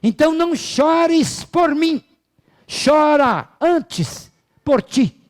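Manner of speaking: loud, high, drawn-out admonition; orator's ringing, stately exhortation; declaiming Socrates. Retold or imitated. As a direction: proclaim it slowly, stretching each phrase. Voicing Socrates's solemn admonition.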